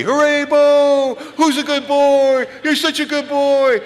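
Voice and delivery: put-on very high, loud, slow baby talk; praising the puppy. Shown as a demonstration. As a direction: gush over the dog like it's a party. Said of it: "you better throw a party".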